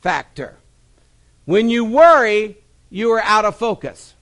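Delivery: rising, loud preacher cadence; preaching. Acted. Pastor preaching worry sermon to congregation, full-force preaching.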